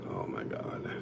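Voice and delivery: breathy whisper of disbelief, very soft; whispered Oh my God. Retold or imitated. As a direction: mutter it under your breath in shock. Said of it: "I'm like".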